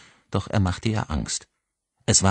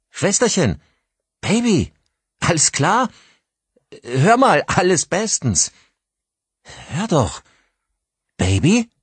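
high, loud worried calls; anxious calling; 13 semitones higher, 6 dB louder than usual. Acted. Brother calling his sister after the crash, anxious and high. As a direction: call out for her, growing afraid.

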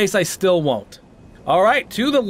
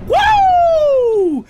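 loud, very high drawn-out "woo"; victory whoop; 6 dB louder than usual. Real, not acted. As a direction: whoop at the top of your voice. Second victory cry.

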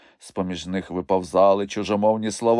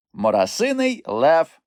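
slow, higher proud announcement; 8 semitones higher, 5 dB louder than usual. A narrator's reading. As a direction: show off the catch. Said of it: "гордо відказав Мумітроль". (гордо, повільно)

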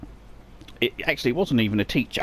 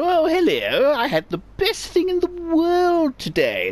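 high put-on posh voice, much higher; mock posh child; 13 semitones higher than usual. Boy showing off posh voice to family. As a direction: mimic the posh headmaster at home.

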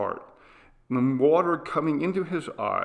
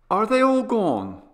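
put-on high, loud, eager boy's question; keyed-up child, bursting to talk; 11 semitones higher, 8 dB louder than usual. A narrator's reading. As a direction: ask it eagerly, voice pitched right up. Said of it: "he said eagerly".

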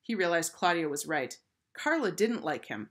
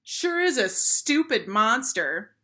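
loud, slow, higher-pitched taunt; drawn-out schoolkid jeer; shouted jeer; 6 semitones higher, 7 dB louder, slower than usual. A narrator's reading. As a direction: shout it across the playground. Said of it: "shouted a third grader".

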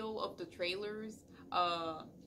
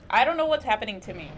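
a quick, loud burst, then easing off; flustered by too much excitement; overexcited; 10 dB louder, faster than usual. Real, not acted. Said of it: "I'm getting too overexcited".

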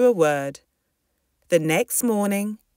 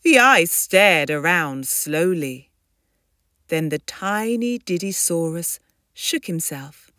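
plain reading voice

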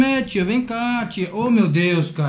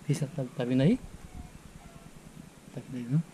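softly, low, watching for her; hushed aside; 9 semitones lower, 10 dB quieter than usual. Real, not acted. Nephew checking whether his aunt is coming, hushed. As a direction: murmur it secretly to the camera. Aunt may overhear the setup.

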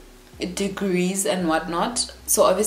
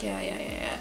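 dismissive; 11 semitones lower, 9 dB quieter, faster than usual. Retold or imitated. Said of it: "every time I was just like".

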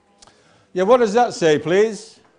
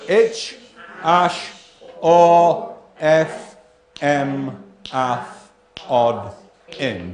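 each syllable apart, the first louder; 3 dB louder, much slower than usual. Shown as a demonstration. Teacher sounding out number six with the class. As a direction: beat out each nonsense syllable. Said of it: "everybody, what does it say?".

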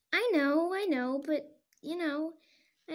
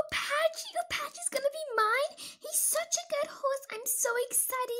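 excited; 9 semitones higher than usual. Acted. [name] thrilled about her horse.

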